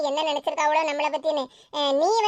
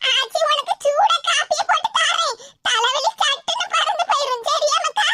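fretting aachi, voice pitched up and pleading; worried grandmother; 9 semitones higher than usual. Acted. Grandmother fussing over the sobbing girl, high anxious call.

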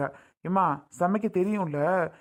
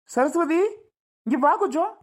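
calling out; 9 semitones higher, 3 dB louder than usual. Acted. Husband calls wife again.

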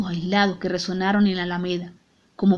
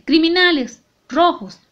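higher, louder shouted insults; 8 semitones higher, 3 dB louder than usual. A narrator's reading. Father forced to insult the prisoners, growing louder. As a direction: build it up into a shout. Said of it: "cada vez más fuerte".

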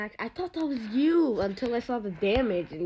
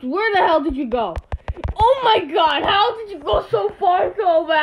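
appalled; 4 semitones higher, 7 dB louder than usual. Real, not acted. Opponent escapes unexpectedly.

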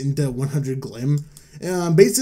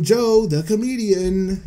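mock comedian announcer; 5 semitones higher, slower than usual. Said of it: "wannabe funny comedian".

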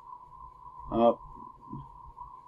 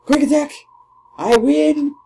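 high, loud cheer; excited shout; 11 semitones higher, 8 dB louder than usual. Real, not acted. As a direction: call it out in triumph. Calls attack, wins.

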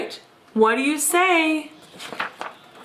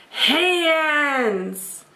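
ordinary delivery, nothing marked

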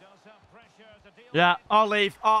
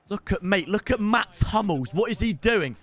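ordinary delivery

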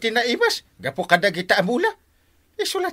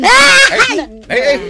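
high, loud alarmed cries; shrill cry out; 11 dB louder, much slower than usual. Acted. Captain crying out as the women scuffle, loud and high. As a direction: cry out high as the fight breaks out.

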